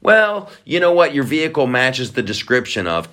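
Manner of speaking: ordinary talk, nothing marked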